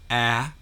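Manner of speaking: "a" alone as the apple vowel; isolated phonics "a", as in apple; first sound isolated. Shown as a demonstration. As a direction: say "a" as a lone short A. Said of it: "The first sound is".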